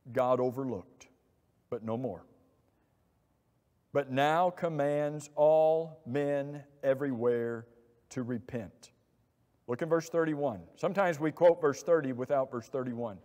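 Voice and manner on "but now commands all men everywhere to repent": usual speaking voice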